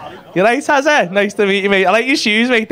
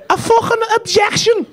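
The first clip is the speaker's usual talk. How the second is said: mock barrister; 10 semitones higher than usual. Imagining Maya as barrister.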